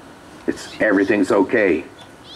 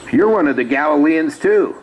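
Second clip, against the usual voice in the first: high-pitched mock accusation; imitated servant girl's challenge; accusing servant girl; 8 semitones higher, 3 dB louder than usual. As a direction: point him out in a raised, girlish pitch. Servant girl accuses Peter in story.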